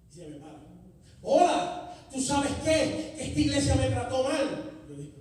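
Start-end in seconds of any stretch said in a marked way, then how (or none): 1.2-4.7 put-on angry young man. Young man confronts the preacher.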